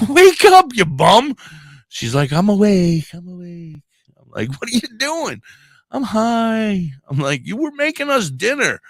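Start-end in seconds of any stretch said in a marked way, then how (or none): 0.1-1.3 much higher and louder playful yell; husband finds his wife out cold on the bed, loud teasing yell; mock shout. Waking his passed-out wife. 2.3-8.8 stoned wife. Retold or imitated. Voices his stoned wife.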